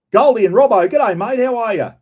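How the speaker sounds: big, high g'day called out loud; excited greeting. Real, not acted. Greeting Goldie and Robbo in the live chat, excited and loud. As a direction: hail the mates warmly and loudly.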